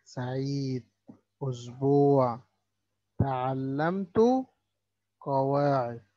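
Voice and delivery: سعيد أسبوع تعلمت قواعد with the ain pronounced clearly; clear ain demo. Shown as a demonstration. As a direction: say each word slowly, stressing the ain. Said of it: "بعض الكلمات اللي فيها حرف العين". (نطق واضح لحرف العين)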